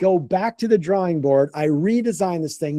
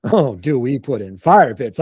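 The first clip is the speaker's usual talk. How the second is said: low, slow mock pondering; drawn-out, playful salesman's echo; knowing echo; 5 semitones lower, 3 dB louder than usual. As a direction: savour the question as if it's obvious. Salesman echoes question, relishing it.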